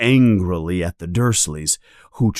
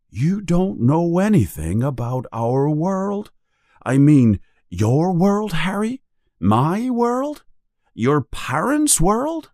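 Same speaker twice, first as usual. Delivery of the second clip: high, rising questions in disbelief; incredulous giant; 9 semitones higher than usual. A narrator's reading. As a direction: pile up astonished questions as a giant.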